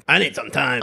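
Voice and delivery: a mild sulky snap; curt storming-off line. Shown as a demonstration. Walking out on a coworker, mildly curt and sulky. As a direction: toss it off as you storm out. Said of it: "kinda stomp out of the room and say".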